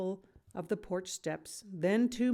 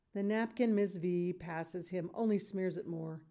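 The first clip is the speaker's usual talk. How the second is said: ordinary delivery, nothing marked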